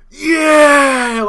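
high, loud retold crowd roar; crowd cheering. Angry construction workers answering their union boss, loud mock roar. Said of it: "And the whole crowd goes".

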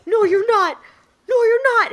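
put-on high, petulant kid brother insisting no; whiny brother denial. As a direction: deny it like a sulking sibling. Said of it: "he was like".

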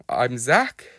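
ordinary delivery

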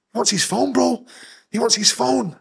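put-on half-hearted Birmingham accent; Brummy accent. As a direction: mimic the Brummy guy, lightly. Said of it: "I think one of them was Brummy".

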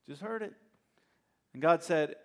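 ordinary delivery

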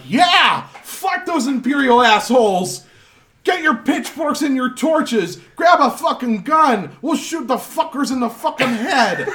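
high, loud angry crowd roar; mob shouting. Acted. Angry townsfolk roaring for revenge on the Imperials, loud shout. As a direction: rile up the mob. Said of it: "People are like".

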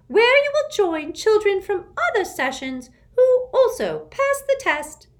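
high, loud prim official voice; a strict, shrill-free schoolmistress; stern test administrator. A narrator's reading.

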